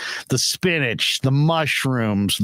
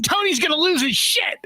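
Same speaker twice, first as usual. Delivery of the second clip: mock panicked yell, much higher; imitated yelling. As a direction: squeal it like a boss in trouble. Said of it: "He's screaming, he's yelling".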